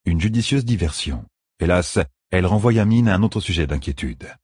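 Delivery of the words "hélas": usual speaking voice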